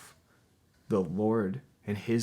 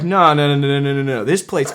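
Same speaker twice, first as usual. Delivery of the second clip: louder and higher hammed-up protest; mock outraged refusal; 6 semitones higher, 13 dB louder than usual. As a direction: blurt the noes in mock horror. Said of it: "I'm like".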